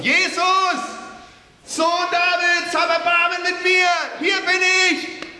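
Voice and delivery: high, loud cries for help; desperate shouting. Acted. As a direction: yell across the crowd to be heard. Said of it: "er fängt an zu rufen".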